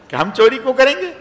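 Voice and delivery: high, loud, shocked denial; indignant outcry. Retold or imitated. Brothers protest to the palace guards, indignant outcry. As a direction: protest the accusation loudly. Brothers deny theft, outraged.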